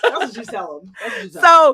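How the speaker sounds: laughing, loud call across; laughing shout. Real, not acted. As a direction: yell it out while laughing.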